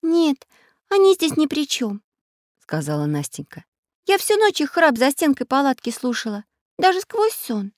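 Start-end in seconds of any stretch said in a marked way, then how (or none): 0.0-2.0 put-on high-pitched girl, objecting; a high little voice, disagreeing; little girl Настенька. A narrator's reading. Girl defending others to her friends, objecting. 4.1-7.7 put-on high-pitched girl explaining; a child's high voice, insisting; little girl Настенька. A narrator's reading. Girl telling friends what she heard all night, insisting.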